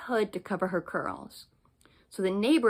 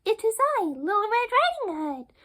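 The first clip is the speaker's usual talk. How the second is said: put-on much higher, innocent child; little girl voice; 13 semitones higher than usual. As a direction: answer brightly like a small girl. Girl at the door.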